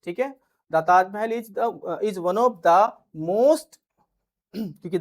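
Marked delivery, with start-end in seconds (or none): 0.7-3.8 each word spelled out slowly for students to write; slow dictation. Shown as a demonstration. Slow dictation of example sentences.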